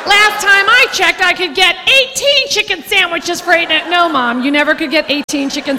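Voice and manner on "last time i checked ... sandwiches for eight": lightly voiced mother scolding the waiter; a hint of a scolding mother. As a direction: tell off the waiter like an old lady. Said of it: "old-time expression".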